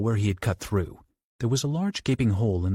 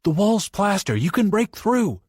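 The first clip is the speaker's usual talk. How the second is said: higher, louder urgent call; 11 semitones higher, 4 dB louder than usual. Acted. Johnny calls urgently to Bobby through the wall, loud. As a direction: shout instructions through the wall.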